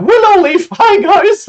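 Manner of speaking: loud, very high-pitched elf squeal; high-strung elf. Shown as a demonstration. Game master greets players as an elf, high-strung. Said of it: "the high-strung elf".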